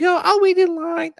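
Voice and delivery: squeaky, prim wife being modest; imitating wife insisting on waiting; mock wife voice. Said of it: "The voice comes out".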